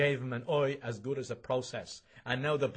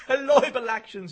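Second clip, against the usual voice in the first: laughing; 12 semitones higher, 11 dB louder than usual. Acted. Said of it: "and he doubled up".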